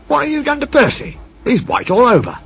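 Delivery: high, startled signalman voice; the signalman gasps at the sight. Acted. Signalman character voice.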